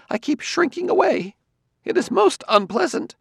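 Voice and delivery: lightly voiced uneasy scholar; Quemot's voice. A narrator's reading.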